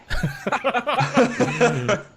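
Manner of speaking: loud high burst of laughter; real high-pitched cackling laugh, loud. Real, not acted. Player laughs after running away from the fight, loud high laughter.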